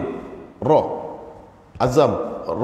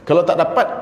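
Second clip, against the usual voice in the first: usual speaking voice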